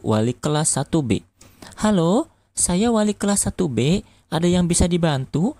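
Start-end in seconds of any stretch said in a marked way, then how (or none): 1.8-5.5 high-pitched voice for a woman teacher; higher, polite schoolmistress voice; female teacher. A narrator's reading. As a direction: answer the phone as a lady teacher, pitched up. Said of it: "tanya Bu Guru".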